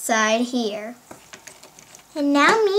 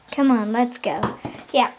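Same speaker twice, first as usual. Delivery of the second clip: ordinary talk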